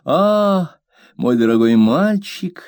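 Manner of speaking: high, fond cry; mother calling to her son; exclaiming tenderly. A narrator's reading. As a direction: turn to the boy for comfort. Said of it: "воскликнула моя мать".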